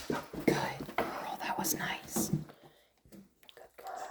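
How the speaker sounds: breathy hushed praise; whispered praise. Real, not acted. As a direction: whisper it to the dog, hushed and gentle. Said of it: "praising dog in whisper".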